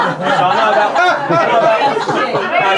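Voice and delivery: laughing through the words; breaking into laughter mid-sentence. Real, not acted.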